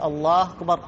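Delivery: الله أكبر run together as "Allaahkbar"; dropped hamza. Shown as a demonstration. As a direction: swallow the first vowel of أكبر, "Allaah-kbar". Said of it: "ما عاد ينطق الهمزة". (إسقاط همزة "أكبر")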